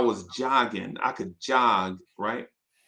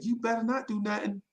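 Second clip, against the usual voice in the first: mock brother warning; 8 semitones higher, 6 dB quieter than usual. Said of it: "And he said".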